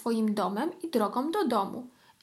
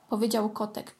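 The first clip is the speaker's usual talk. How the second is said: usual speaking voice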